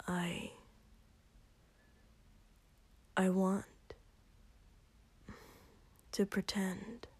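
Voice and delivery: soft hesitant whisper. Acted. Shoto confessing his wish to Katsuki, hesitant whisper. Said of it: "Shoto whispered".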